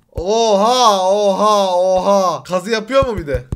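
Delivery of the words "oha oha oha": loud, repeated gasps of amazement; stunned excited cries; amazed. Real, not acted. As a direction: keep shouting it in awe. Amazed at what was built.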